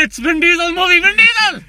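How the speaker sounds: high, loud panicked yelling; mock panic. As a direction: yell it like a desperate hotline caller. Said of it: "That's like a porn panic line".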